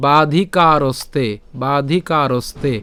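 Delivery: slow বাধিকারস্তে with long vowels and joined "ste"; careful Sanskrit pronunciation. Shown as a demonstration. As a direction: draw out বাধিকারস্তে, keeping স and ত together. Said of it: "উচ্চারণটা কেমন হচ্ছে?". (ধীরে বাধিকারস্তে, স ও ত একসাথে)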